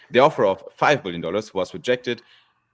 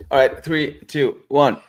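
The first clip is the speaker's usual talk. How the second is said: higher and louder countdown to start; 7 semitones higher, 6 dB louder, slower than usual. Real, not acted. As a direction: call out the count to start recording.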